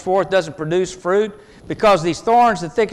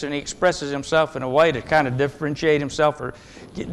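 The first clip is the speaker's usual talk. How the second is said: normal talking voice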